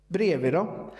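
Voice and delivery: usual speaking voice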